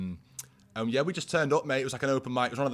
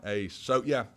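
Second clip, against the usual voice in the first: ordinary delivery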